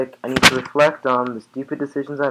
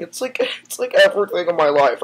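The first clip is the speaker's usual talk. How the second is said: hammed-up crying; 6 semitones higher, 7 dB louder than usual. Fake emotional breakdown.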